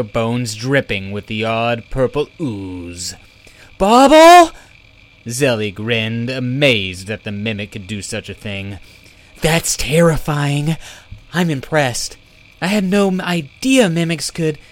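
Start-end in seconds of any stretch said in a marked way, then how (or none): 3.8-4.5 a high, loud, delighted call of the name; excited shout. Acted. Zelly shouting to Bobble in delight, loud and high. 9.4-14.6 impressed. Acted. Zelly admires the skeleton trick.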